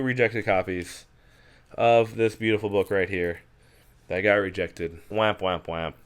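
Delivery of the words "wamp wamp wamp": sung wamp wamp wamp, falling; playful sad trombone noise; mock sad trombone. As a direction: hum a failure jingle in jest.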